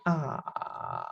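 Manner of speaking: "Ah" sinking to the lowest point, soft and breaking; voice dropped till breaking. Shown as a demonstration. Teacher showing the trick, lowest breaking "Ah". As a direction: drop "Ah" until the voice breaks. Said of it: "drop your voice to the lowest point until it breaks".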